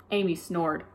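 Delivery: ordinary delivery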